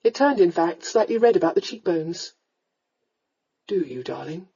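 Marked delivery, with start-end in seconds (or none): 3.7-4.5 low, soft, tender question. A narrator's reading. Lord takes his darling's hand, asking tenderly. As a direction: ask it softly and low, lovingly. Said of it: "he asked tenderly".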